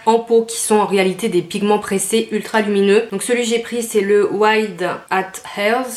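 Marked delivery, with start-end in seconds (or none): 4.3-5.8 English shade name in a French accent, slowly. Real, not acted. Vlogger reading a make-up shade name, French-accented English. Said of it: "un super accent anglais".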